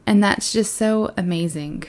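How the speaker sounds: ordinary talk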